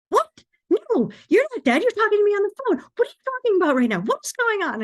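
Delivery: very high, loud, frantic mom impression; mile a minute. Mother hearing daughter ask if she's dead, frantic. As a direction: fire off alarmed questions at double speed. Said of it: "she's going a mile a minute".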